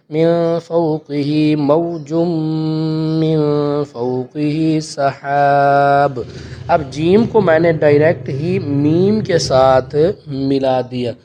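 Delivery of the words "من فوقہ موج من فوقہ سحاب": slow chant with a stop after موج; correct recitation. Shown as a demonstration. Teacher models the right stop for students, slow chant. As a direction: recite slowly, pausing on موج. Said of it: "تو اس کو اس طرح پڑھیں گے".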